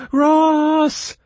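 high, loud cry of a name. Acted. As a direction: call out in alarm. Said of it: "Kira's cry".

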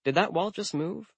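a tight, anxious question; tight, nervous. A narrator's reading. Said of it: "her voice tight".

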